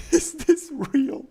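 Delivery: loud, high, drawn-out disbelief through laughter; incredulous laughing. Real, not acted. Streamer reacting to a skin search, incredulous laughing. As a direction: crack up while asking, high and slow. Cracks up at what appears on screen.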